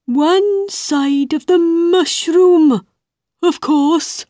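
high caterpillar voice; a knowing bug giving a hint. Acted.

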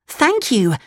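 put-on high puppet-boy voice; high storybook Pinocchio; grateful Pinocchio. A narrator's reading. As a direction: pitch it up, politely thankful. Pinocchio thanks the fox.

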